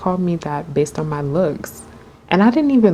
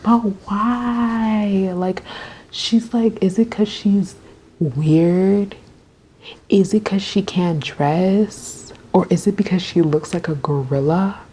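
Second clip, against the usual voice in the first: higher, half-whispered mock questions; imitating Zoe; 4 semitones higher, slower than usual. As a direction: tease like a mean girl, pitched up and breathy. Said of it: "she was like".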